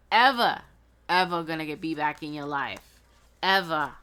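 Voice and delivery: loud, punchy put-on movie accent; comic accent, hammering each "ever"; Chris Tucker. Reader warning off a listener's ex, loud mock movie accent. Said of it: "had to say it like that with an accent".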